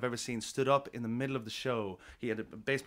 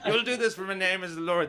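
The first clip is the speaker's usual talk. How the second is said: a hint of a slurred, boozy God, a little louder; drunk God; 7 semitones higher, 5 dB louder than usual. Comedian voices a drunk God writing the Bible, lightly slurred. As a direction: play God proclaiming after a few drinks. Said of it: "God was drunk when he wrote the Bible".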